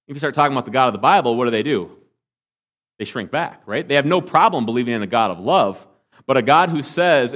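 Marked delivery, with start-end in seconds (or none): none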